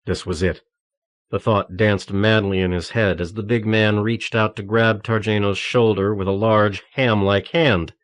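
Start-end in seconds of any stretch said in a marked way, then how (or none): none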